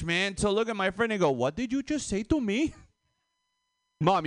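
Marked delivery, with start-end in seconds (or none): 1.3-2.7 high-pitched mock affront; rude challenge. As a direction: imitate mom sounding accidentally rude. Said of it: "it sounds so rude in English".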